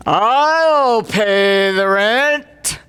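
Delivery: very slow, grand heroic declaration; heroic rescuer. Acted. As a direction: announce it like a noble hero. The hero rescues the victim.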